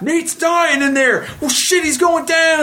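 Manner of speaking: high, slow, gossiping worry; mock panicked coworkers. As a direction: squeal it like nervous onlookers. Coworkers in hallway, mimicked.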